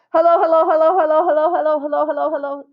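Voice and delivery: rapid-fire high loud hellos; as fast as possible. A narrator's reading. Lori taunting Jeff, rattling off hellos fast. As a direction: rattle them off as fast as you can. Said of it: "said Lori as fast as she could".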